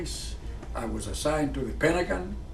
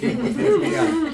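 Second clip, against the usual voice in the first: laughing; 13 semitones higher, 8 dB louder than usual. Real, not acted. Joking threat ends in laughter.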